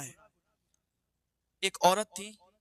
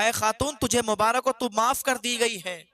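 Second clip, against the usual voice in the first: joyful announcement; 4 semitones higher, 7 dB louder than usual. Acted. Moses calls out the good news.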